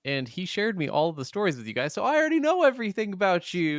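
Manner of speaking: high, fast and bubbly; chirpy pretend twin. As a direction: gush it out cheerfully.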